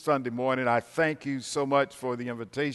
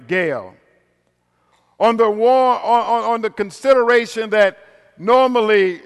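preaching at full force; 6 semitones higher, 9 dB louder than usual. Acted. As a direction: preach it out to the congregation.

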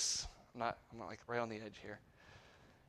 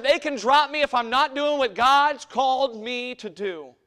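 slow, high, full-force shouted declaration; emphatic acted yell across the room; yelled back; 12 semitones higher, 13 dB louder, slower than usual. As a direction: yell it back, drawing out each word. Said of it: "He yelled back and said".

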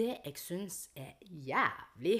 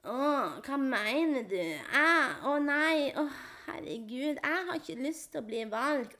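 higher, drawn-out southern Norwegian lilt; mock southern Norwegian sing-song, a touch exaggerated; mock southern Norwegian accent; 7 semitones higher, slower than usual. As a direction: parody a whiny southern Norwegian dialect. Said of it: "den der sydinga, bare den dia-, dialekten, stemmeleie".

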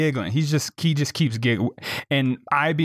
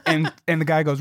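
usual speaking voice

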